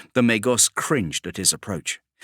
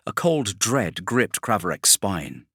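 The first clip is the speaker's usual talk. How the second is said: ordinary talk, nothing marked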